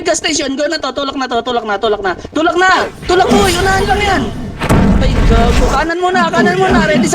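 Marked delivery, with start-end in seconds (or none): 2.3-6.9 high, loud push call; rally the squad with a yell; excited shout. Real, not acted. Shotcaller yelling at teammates to push now, high excited shout.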